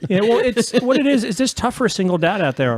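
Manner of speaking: words broken by laughter; chuckling through the line; laughing while talking. Real, not acted. Joking about single dad life.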